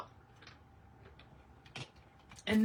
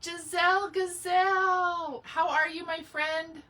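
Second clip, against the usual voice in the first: delighted sing-song greeting; 6 semitones higher, 5 dB louder, slower than usual. Real, not acted. Greeting a favourite live viewer.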